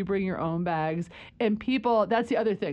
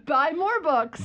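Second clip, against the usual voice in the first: slogan voice; 7 semitones higher, slower than usual. Acted. Joking slogan.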